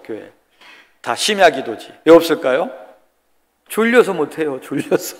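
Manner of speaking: ordinary talk, nothing marked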